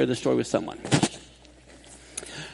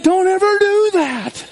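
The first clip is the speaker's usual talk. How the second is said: stern warning; 13 semitones higher, 6 dB louder, slower than usual. Retold or imitated. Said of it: "And I said".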